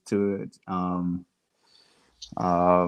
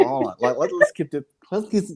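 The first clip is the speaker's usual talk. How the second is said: usual speaking voice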